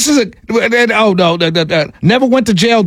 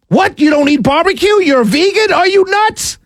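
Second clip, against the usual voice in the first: mock disbelief; 7 semitones higher than usual. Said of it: "mocking critics".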